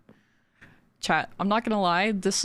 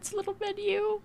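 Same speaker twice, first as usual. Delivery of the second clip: cute tiny voice; 13 semitones higher, 4 dB quieter, faster than usual. Acted. Cooing over tiny drawn menu.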